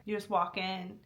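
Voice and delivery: ordinary delivery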